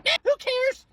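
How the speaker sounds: high, squawky mock dismissal; put-on high-pitched shrug; mocking indifference. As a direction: toss it off in a silly high voice.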